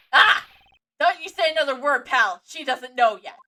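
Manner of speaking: loud, urgent shout to cut him off; angry shout. Acted. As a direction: bark it out to silence him. Detective shouts to stop Edgeworth.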